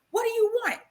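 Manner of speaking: high, distant voice of God asking; God questioning her from above. Retold or imitated. God asks the praying wife what she wants.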